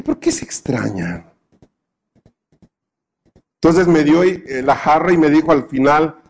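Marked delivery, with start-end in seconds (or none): 0.0-1.3 low, soft, small voice; puzzled child. Retold or imitated. Boy wonders why the cook is surprised, low soft voice. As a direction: mutter the question under your breath.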